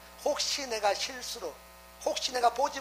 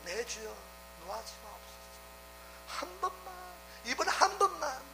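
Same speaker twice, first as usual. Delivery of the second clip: a much softer, near-hushed entreaty; hushed plea; 2 semitones higher, 7 dB quieter than usual. Retold or imitated. Abraham humbly begging God one last time, much softer. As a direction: drop to a humble, quiet beg.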